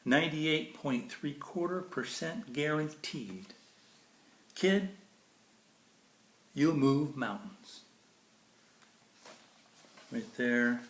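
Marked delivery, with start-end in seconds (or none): 0.0-7.8 bright, lilting storybook read; sing-song picture book reading; reading to children. Acted. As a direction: read it like bedtime verse for a child. Reads Dr. Seuss to kids.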